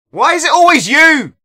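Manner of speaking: high, loud exasperated yell; loud sharp voice. Real, not acted. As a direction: shout it at the player in disbelief. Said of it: "Why are you yelling?".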